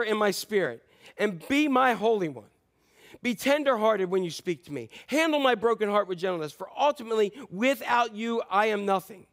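lament prayer. Acted. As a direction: cry out to God as if in anguish. Reads a lament prayer aloud.

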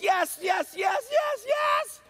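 rising high victory yell; Super Bowl victory shout. Retold or imitated. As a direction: yell like the Eagles just won. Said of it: "All I said was".

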